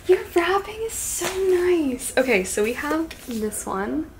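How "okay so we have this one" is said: ordinary delivery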